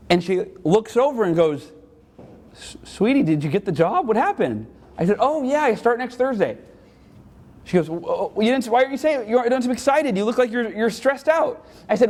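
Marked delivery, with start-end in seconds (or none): 2.5-11.7 worried mom. Retold or imitated. Mom voiced in story.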